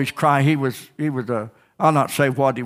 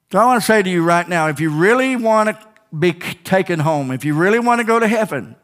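raised, driving preacher's cry; fiery preaching; 3 semitones higher than usual. Acted. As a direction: push every line out to the congregation. Preaching at full force.

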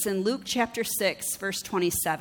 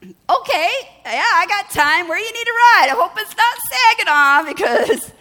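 much higher and louder, half-panicked agreement; flustered mock eagerness; 10 semitones higher, 11 dB louder than usual. Preacher agreeing to drive a stranger, high, loud and flustered. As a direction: gush yes while panicking inside. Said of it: "I was like".

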